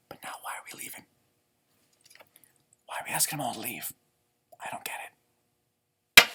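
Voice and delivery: put-on puzzled whisper to the kids. A narrator's reading. Storyteller wondering why Owl sends guests home, whispered. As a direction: hush it like a secret question.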